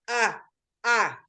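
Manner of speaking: short, separate "Ah" sounds; phonics sound demo. Shown as a demonstration. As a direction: say "Ah" as an isolated letter sound. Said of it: "Then I go".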